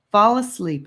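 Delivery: ordinary delivery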